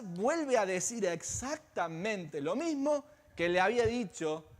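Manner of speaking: normal talking voice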